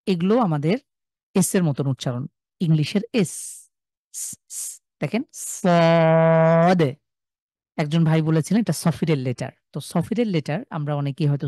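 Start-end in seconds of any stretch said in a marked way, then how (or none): 4.1-4.8 long whispered hiss of স; hissing s sound. Shown as a demonstration. Teacher hisses স to show the whistling letters. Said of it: "স একটা আওয়াজ আসছে". 5.0-6.8 very slow সদ with a long hissing স; drawn-out sad. Shown as a demonstration. Teacher drags out সদ to show its hiss. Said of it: "স একটা আওয়াজ আসছে".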